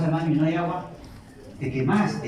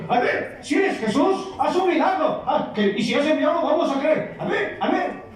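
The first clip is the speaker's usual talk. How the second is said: much higher, taunting with a laugh; mocking tempter; 10 semitones higher than usual. Tempter taunting Jesus for a miracle, high and laughing. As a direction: goad him with a sneering laugh.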